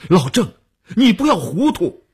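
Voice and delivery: loud, high involuntary cry; sudden shout. A narrator's reading. Boss crying out to his aide at the door, alarmed shout. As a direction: shout to pull him back from folly. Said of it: "不由得喊了一声".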